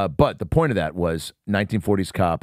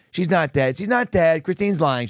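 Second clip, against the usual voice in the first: high-pitched imitated objection; mock protesting; 8 semitones higher than usual. Kids contradict Christine's claim to the cop, imitated protest. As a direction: insist on it in a raised pitch. Acting out protest at kid's lie.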